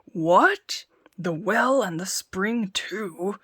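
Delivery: slow, loud growl into his beard; growling giant. Acted. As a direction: grumble in alarm. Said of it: "growled the giant in his beard".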